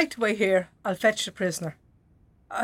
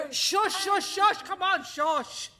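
hushing; 5 semitones higher, slower than usual. Real, not acted. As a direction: hush the crowd down. Guard hushes the prisoners.

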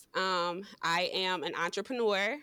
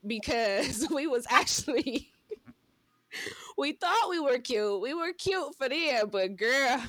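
giggling through the words, higher up; laughing; 5 semitones higher than usual. Real, not acted. Teasing her college self with a friend, laughing.

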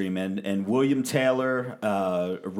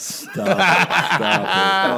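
high, loud laughing stop it; laughing protest; 9 semitones higher, 7 dB louder, much slower than usual. Real, not acted. Pleading with friends to stop, laughing loud. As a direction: beg them to quit through laughter.

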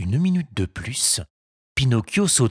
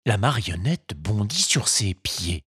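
ordinary delivery, nothing marked

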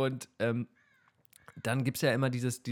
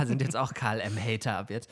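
ordinary talk

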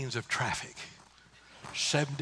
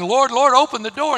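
loud, higher desperate cry; 8 semitones higher, 12 dB louder than usual. A narrator's reading. Shut-out virgins crying to the Lord at the door, loud and desperate. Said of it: "they're crying".

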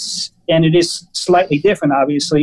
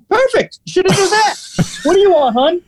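much higher, punchy imitation of a brash waiter; aggressive waiter; 14 semitones higher than usual. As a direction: act out the waiter snapping up the order, then turning to the next diner. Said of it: "Really super aggressive".

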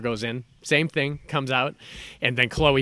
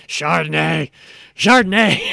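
drawn-out hungry zombie groan, rising into a loud, higher moan; 4 semitones higher, 7 dB louder, slower than usual. Retold or imitated. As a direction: moan it like a dazed zombie craving wine. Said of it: "Carol Kane walking around at one point going".

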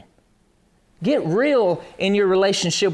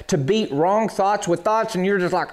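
usual speaking voice

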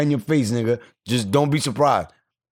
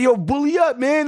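high shouted hype; calling out. Retold or imitated. Fan hyping the player, high shouted call. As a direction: shout him on from the crowd. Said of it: "I'm like".

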